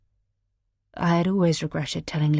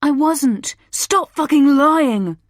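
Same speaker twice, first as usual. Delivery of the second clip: high, loud furious yell; 9 semitones higher, 7 dB louder, slower than usual. A narrator's reading. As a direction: scream the denial, slowly. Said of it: "Ben yelled".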